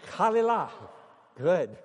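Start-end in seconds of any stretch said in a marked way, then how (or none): 0.1-0.7 "Chalilah" high and loud, leaning on the final "lah"; stress on last syllable. Shown as a demonstration. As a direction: model "Chalilah" loudly for the congregation to repeat. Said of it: "would you say it?".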